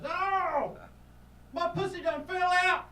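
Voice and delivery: very high, loud, slow shriek; drunk woman shriek. Retold or imitated. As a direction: scream it out in shock. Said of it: "She goes".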